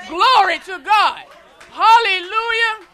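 loud, high call to praise; shouted praise. Real, not acted. Preacher shouting praise to the standing congregation. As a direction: lift the voice and shout it out. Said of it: "we must lift our voice".

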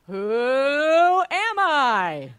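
slow, booming, drawn-out title call; game show announcer. Shown as a demonstration. Host booming the game show title to the Bible study group. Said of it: "I worked on different emphasis".

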